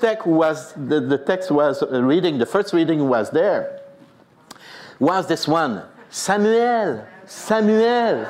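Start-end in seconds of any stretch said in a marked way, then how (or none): 6.1-8.3 calling voice. Retold or imitated. Scripture reading of God's call.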